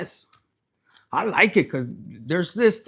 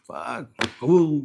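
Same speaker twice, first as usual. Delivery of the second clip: ordinary talk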